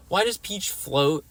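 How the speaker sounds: normal talking voice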